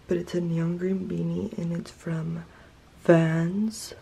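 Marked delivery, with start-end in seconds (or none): none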